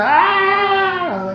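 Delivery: high mock crying wail of a sulking child; child's fake wail. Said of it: "Lalakasan niya, iyak niya". (panggagaya sa malakas na iyak ng bata)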